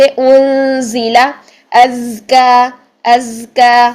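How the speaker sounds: slow انزل with drawn-out sounds; buzzing za drill. Shown as a demonstration. Teacher giving za examples, ازکا with sustained whistling z. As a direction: hold the voiced z in ازکا, long final aa. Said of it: "اور اسی طریقے سے".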